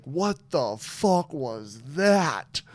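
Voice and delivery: very slow, every word stretched out; drawn-out bewilderment. Retold or imitated. Voicing the puppy's look after the seizure, dazed and slow. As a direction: stretch it out in mock stunned disbelief.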